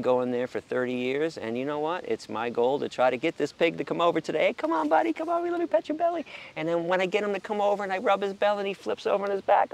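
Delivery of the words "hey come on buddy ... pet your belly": very high-pitched, eager pet-talk; coaxing an animal. Retold or imitated. Man calling a pig for a belly rub, high coaxing voice. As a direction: coax a pig over in a high, babying voice.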